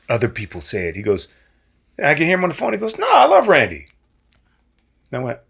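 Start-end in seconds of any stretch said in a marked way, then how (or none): 3.0-3.9 high, loud cheerful denial. Retold or imitated. John on phone.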